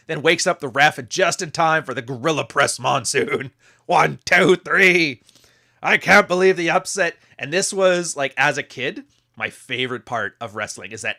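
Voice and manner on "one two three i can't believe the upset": louder, higher pin count and upset call; excited count. Pretend. Counting the pin, announcer hype.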